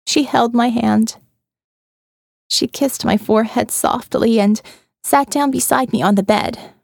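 ordinary delivery, nothing marked

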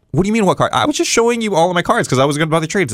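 high, insistent disbelief; indignant kid protest. Retold or imitated. Kid protesting to the shop owner, high and indignant.

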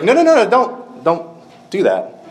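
high, urgent no no no, pleading him to stop; alarmed protest. Retold or imitated. Girl begging her father not to smash the glass, high and alarmed. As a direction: snap out a panicked plea to stop the throw.

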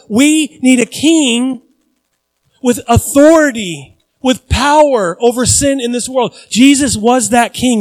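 loud, high preaching voice; rising preacher's fervour; preaching at full force. Acted. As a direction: proclaim it to the hall. Preaching builds.

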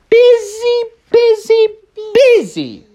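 high, loud, bouncing repeat; sing-song busy. A narrator's reading. Narrator stresses how busy Carl was, high and sing-song. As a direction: stretch each busy up high.

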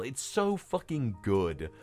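ordinary talk, nothing marked

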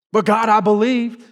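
put-on pleading to God like a sulking kid; mock tantrum. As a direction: play a hurt child stretching the words. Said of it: "You throw a tantrum".

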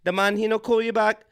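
lightly Jamaican psychic breaking bad news; Miss Cleo impression. Psychic telling a caller about her man, mock Jamaican. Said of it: "And be like".

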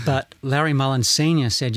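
usual speaking voice

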